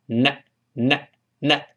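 na said slowly, tongue on the front of the palate; slow na drill; tongue-tip na. Shown as a demonstration. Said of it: "这个位置发".

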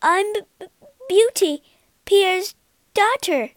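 high, halting, scared voice; frightened stammer. A narrator's reading. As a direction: stammer nervously before a monster. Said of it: "she stammered".

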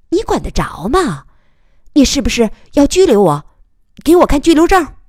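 high, defiant volley building to loud; rapid-fire tirade. A narrator's reading. Reporter bluffing the officer over binoculars, angry barrage. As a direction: fire off challenges, ending in a loud demand. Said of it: "这一排乱炮".

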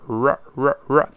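R with the tongue hooked back; curled-tongue R. Shown as a demonstration. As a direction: repeat R slowly, curling the tongue back. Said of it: "一定要勾舌头往回勾".

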